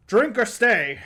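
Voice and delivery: loud, gruff barkeep greeting; gruff innkeeper. Acted. Innkeeper calling out to the party entering, gruff. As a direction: bark it at the newcomers.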